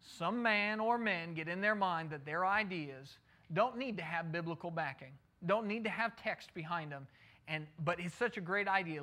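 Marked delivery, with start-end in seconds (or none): none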